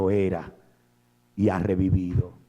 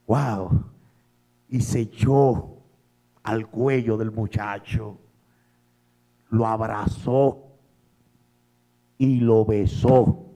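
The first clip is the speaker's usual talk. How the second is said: ordinary talk, nothing marked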